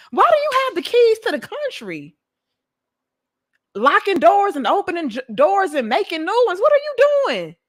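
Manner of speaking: high, loud shrill-toned outrage from a woman; angry woman voice. Retold or imitated. Women storming in, yelling at the man holding the keys, in a high angry voice. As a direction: yell at him like an indignant woman taking charge. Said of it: "women coming through like".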